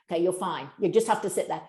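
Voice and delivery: ordinary delivery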